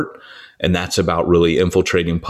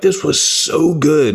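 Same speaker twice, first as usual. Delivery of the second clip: higher, slow, drawn-out praise; imitated gushing; 7 semitones higher, slower than usual. Imitating guests at the end of the night, gushing. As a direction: gush like a delighted guest.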